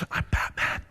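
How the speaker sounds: gravelly whispered Batman growl. Retold or imitated. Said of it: "I said".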